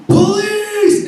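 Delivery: high shouted call for police; shouting police through the door; screamed. Retold or imitated. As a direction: yell it high to scare them off. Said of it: "and I screamed".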